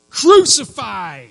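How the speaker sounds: loud, high, drawn-out yell; crowd shout. Acted. Preacher as crowd condemning Jesus, forceful shout. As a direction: shout it like a mob demanding death. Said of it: "the crowds shouting".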